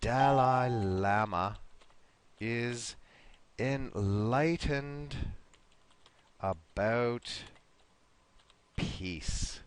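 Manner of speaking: slow, word by word with long pauses; slow model sentence. Shown as a demonstration. Teacher modelling a sentence for the class, slow and spaced out. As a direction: stretch each word out, pausing between them.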